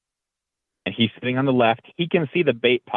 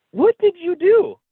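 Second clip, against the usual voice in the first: much higher, louder, slow cry of disbelief; stunned kid; 5 dB louder, slower than usual. Retold or imitated. Boy stunned after grandfather shot the doe, high and slow. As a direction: let the shock stretch out high.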